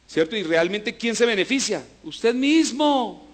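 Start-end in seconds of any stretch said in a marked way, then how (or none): 2.0-3.3 slow and higher, leaning on mismo; emphatic answer. Acted. Preacher answers his own question, slow and emphatic. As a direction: land the answer hard and slow.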